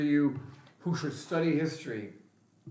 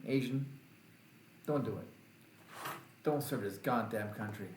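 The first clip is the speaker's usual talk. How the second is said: ordinary delivery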